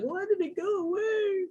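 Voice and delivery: high mock-panicked viewer question; whiny viewer. Host mimicking a confused viewer whose screen vanished, high whine. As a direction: play the confused student fretting.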